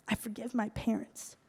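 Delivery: softly voiced, tentative retold confession; hushed forgiveness. Acting out father's first words of forgiveness.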